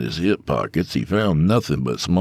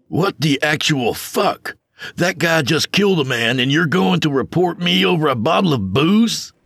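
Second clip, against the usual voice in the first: outraged; 11 semitones higher than usual. Acted. Tony protests to the bartender, outraged. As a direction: demand it in disbelief.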